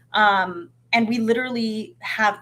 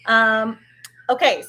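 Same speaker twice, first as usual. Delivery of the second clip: normal talking voice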